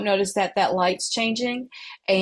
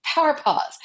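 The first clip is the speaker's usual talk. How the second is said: inner reminder; 8 semitones higher than usual. Retold or imitated. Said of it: "which I literally will say in my brain".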